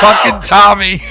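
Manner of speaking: hollering at a buddy; shouting out. Real, not acted. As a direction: yell the name in mock outrage. Shouting a friend's name, laughing.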